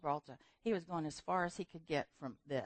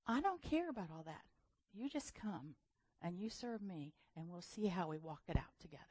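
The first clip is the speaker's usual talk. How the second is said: soft, fading to a whisper; gentle God voice; 9 dB quieter than usual. Retold or imitated. God answering the preacher, softly. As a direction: speak tenderly, almost whispering. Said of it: "And God said".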